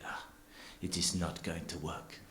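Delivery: softly dismissive mock-French cheesemaker; bad French accent. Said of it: "a French accent, but I'm terrible".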